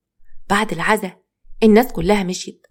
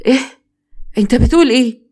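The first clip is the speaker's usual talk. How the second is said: shocked; 4 semitones higher, 4 dB louder, faster than usual. Acted. Father hears shocking phone news.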